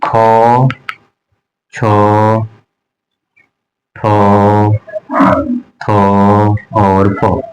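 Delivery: each syllable खो, छो, ठो, थो, फो said slowly and apart with a strong breath burst; teacher sounding out खो छो ठो थो फो with clear aspiration; aspirated consonant drill. Shown as a demonstration. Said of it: "आप देखो तो दूसरा".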